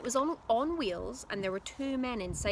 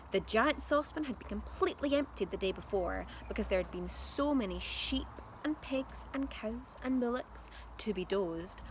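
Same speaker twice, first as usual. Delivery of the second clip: usual speaking voice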